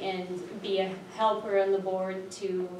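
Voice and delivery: normal talking voice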